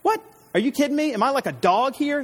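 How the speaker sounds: mock outrage at little David; incredulous Goliath. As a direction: scoff as if insulted by a boy. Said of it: "and he's like".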